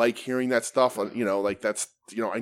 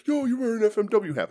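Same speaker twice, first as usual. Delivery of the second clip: fan voice; 10 semitones higher than usual. Retold or imitated. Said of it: "the dude was like".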